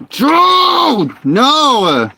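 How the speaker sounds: high, loud yell; shout. Real, not acted. Gamer yelling at the noisy dogs to stop.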